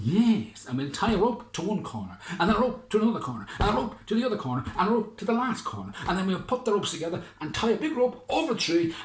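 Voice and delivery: high, loud, quick fisherman; excited fisherman. Acted. Fisherman listing ropes to the mayor, high and fast. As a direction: rattle off the plan excitedly.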